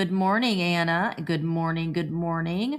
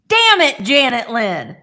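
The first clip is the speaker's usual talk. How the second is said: imitating a mom's high, loud yell, breaking into a laugh; mock furious shout, laughing at the end; 5 semitones higher, 8 dB louder, slower than usual. Retelling how mom yelled at her, loud with a laugh. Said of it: "Or did she say".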